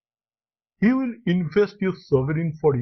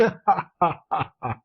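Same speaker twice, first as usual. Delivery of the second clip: hearty laugh. Acted. Alan laughs as Hugh leaves.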